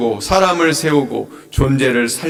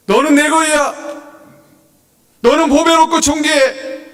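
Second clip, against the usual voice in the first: high, ringing declaration of love; emphatic proclamation; 11 semitones higher than usual. Acted. Preacher voicing God's words to the congregation, high emphatic proclamation. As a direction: proclaim it high and with full conviction.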